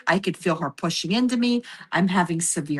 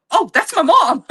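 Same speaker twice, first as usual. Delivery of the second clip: excited recognition; 8 semitones higher, 9 dB louder than usual. Retold or imitated. Recognizing mother's voice.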